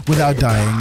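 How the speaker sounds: laughing as he repeats it. Real, not acted. Laughing at near death.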